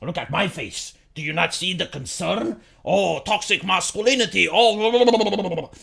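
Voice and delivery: lightly voiced fake-concerned reporter; mock the pundits' pious concern; a hint of a preachy journalist. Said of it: "acting all concerned and they're like".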